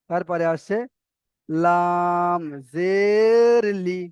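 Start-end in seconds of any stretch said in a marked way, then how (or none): 1.5-4.0 long "লাম", long arched "জের", short "লি"; spelling demonstration. Shown as a demonstration. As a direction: recite slowly, stretching "লাম জের". Teacher models letter-by-letter spelling.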